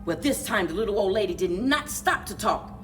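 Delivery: ordinary delivery, nothing marked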